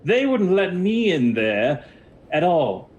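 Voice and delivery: high, loud scoff in wizard voice. Acted. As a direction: scoff it out high, dragging the words. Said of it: "kind of scoffs at you".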